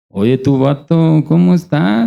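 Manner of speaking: put-on slow, sing-song northern Mexican accent, lightly done. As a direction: drawl "vato" like a norteño. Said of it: "¿cómo habla?". (acento norteño imitado, lento y cantadito)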